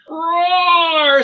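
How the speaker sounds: loud, high drawn-out roar; roaring lion. Acted. Lion waking up in a net, roaring. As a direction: roar out as a startled beast. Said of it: "said the lion".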